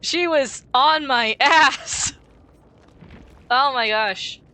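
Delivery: loud, high exclamation with a laugh; exhilarated outburst. Real, not acted. Player just chased by the killer, exhilarated.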